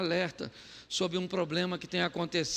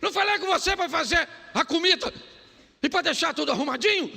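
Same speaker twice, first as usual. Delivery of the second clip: higher, louder nagging reproach; scolding wife; 8 semitones higher, 5 dB louder, faster than usual. Retold or imitated. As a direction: scold him like an irritated wife. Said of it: "Nesse tom".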